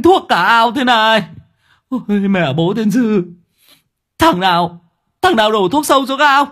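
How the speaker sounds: high-pitched hollering, furious and grieving; wailing yell. A narrator's reading. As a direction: howl in despair at the top of the voice. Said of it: "mà gào lên".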